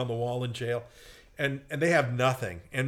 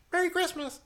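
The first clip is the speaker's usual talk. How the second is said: high squeaky cartoon falsetto; Mickey Mouse. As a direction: pipe up in a high cartoon greeting. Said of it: "he's going like".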